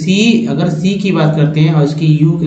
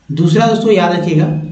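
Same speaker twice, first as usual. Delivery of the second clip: ordinary talk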